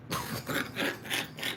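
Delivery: wheezing, voiceless laugh; soft wheeze of laughter; real laugh. Real, not acted. Crude joke, helpless laughter.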